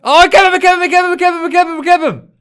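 very high, very loud ecstatic yelling; screaming with excitement. Real, not acted. Gamer screaming to his friend that he found a temple. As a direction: scream it like you just hit the jackpot.

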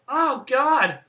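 loud, high farewell holler; shouted sign-off. Real, not acted. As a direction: call out the goodbye, drawing out the last word. Called-out sign-off at video's end.

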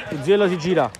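normal talking voice

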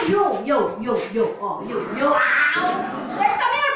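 ordinary talk

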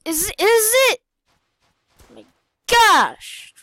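high, loud, incredulous outcry; outraged disbelief. Real, not acted. Can't kill the hacker.

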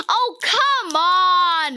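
loud drawn-out groaning protest; frustrated shout. Real, not acted. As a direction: let it out, fed up.

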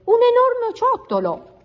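very high, thrilled announcement; high squeal of pride; triumphant exclamation. A narrator's reading. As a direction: proclaim it in a high-pitched burst of triumph. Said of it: "esclamò trionfante".

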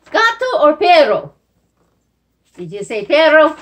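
loud, bright question; excited quizzing. Shown as a demonstration. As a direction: quiz the kids with energy.

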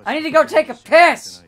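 loud, high, hammed-up careless crew guy; lazy crew member wrapping the take; impatient director. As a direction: shout it off to rush out the door. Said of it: "there's just a guy who's just like".

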